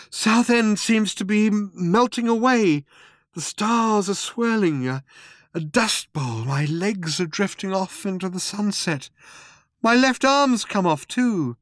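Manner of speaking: high-pitched dismay at a dissolving body; bewildered Arthur, alarmed. A narrator's reading. Arthur as his body melts.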